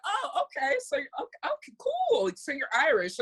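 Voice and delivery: high-pitched, fake-impressed acknowledgement; put-on polite credulity; mock humouring. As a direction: play along as if impressed, pitch leaping up. Mimicking locals humouring fake-Irish tourists.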